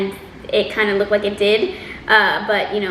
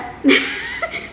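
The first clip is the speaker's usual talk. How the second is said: high laugh. Real, not acted. Reacting to the odd reply.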